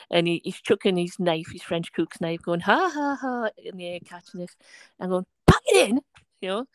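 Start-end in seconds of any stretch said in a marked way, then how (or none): 2.6-3.5 high "ha, ha" in imitation; mimicked taunting laugh. Student chucking his knife in the air, mimicked high laugh. 5.5-6.0 loud, high retold order; sharp telling-off. Teacher to student chucking his knife, loud sharp order.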